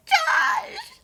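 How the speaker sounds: high, loud, drawn-out cry; mock anguished shout. Younger brother shouting at his brother before shooting, melodramatic. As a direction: cry the name out in hammed-up anguish.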